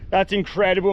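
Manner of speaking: ordinary talk, nothing marked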